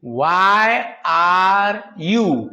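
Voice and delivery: very slow, each word held long; word-by-word modelling. Shown as a demonstration. Teacher building an English question for students, word-by-word modelling. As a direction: stretch out every word for learners.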